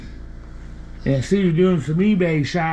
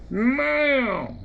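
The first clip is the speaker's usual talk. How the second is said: demo meow; 8 semitones higher than usual. Shown as a demonstration. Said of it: "like that".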